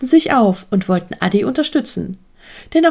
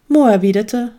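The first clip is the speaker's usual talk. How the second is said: plain reading voice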